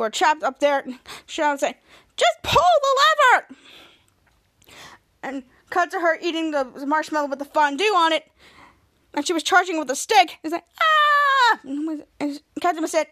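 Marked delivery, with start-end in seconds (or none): 2.2-3.5 high, loud frustrated shout in a put-on voice; impatient yell. Shadow urges from the trap. 10.8-11.6 high, loud charging yell; battle cry. Retold or imitated. Crook charges with a stick.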